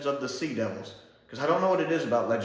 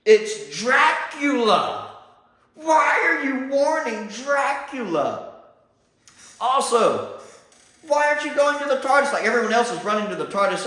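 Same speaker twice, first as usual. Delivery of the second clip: exasperated at the screen; 10 semitones higher, 5 dB louder than usual. Retold or imitated. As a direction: drag out the disbelief at a dumb character. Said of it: "And I'm sitting there like".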